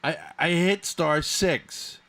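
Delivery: high, loud, slow lament; frustrated groaning. Real, not acted. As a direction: moan it out, baffled. Frustrated after muted meeting.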